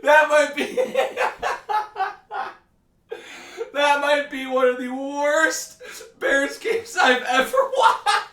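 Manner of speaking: breaking into high laughter mid-sentence; can barely get the words out for laughing. Real, not acted. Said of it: "I can't stop laughing".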